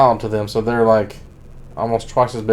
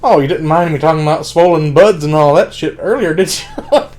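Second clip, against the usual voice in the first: teasing character quote; 4 semitones higher, 6 dB louder than usual. Retold or imitated. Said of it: "comes out of character and he says something like".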